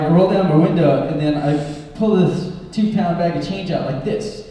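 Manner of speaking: normal talking voice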